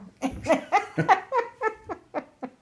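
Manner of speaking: long high bubbling laugh; real laugh. Real, not acted. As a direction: let a real laugh roll out and fade. Laughs at her own story.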